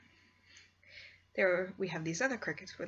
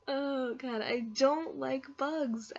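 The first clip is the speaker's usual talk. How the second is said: slow, high whine; high, slow whining; whiny; 7 semitones higher, slower than usual. Real, not acted. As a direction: drag it out, grossed out. Biggest fear is bugs.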